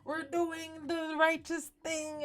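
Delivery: hammed-up righteous toast to the room; pious toast. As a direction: raise the glass and preach it slowly. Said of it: "starts to do a toast".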